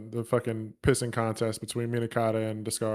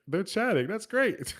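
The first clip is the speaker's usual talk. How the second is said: high naive cheer; 9 semitones higher, slower than usual. Retold or imitated. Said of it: "Ichi's like".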